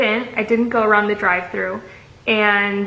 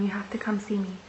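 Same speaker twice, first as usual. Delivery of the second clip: whispered plea; 11 dB quieter than usual. Retold or imitated. Quoting coworker's pleading message.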